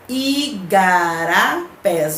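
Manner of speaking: igarapés stretched slowly, syllables apart; teacher slowly sounding out each syllable of "igarapés". Shown as a demonstration. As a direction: break "igarapés" into slow, distinct syllables. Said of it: "só que separando a sílaba".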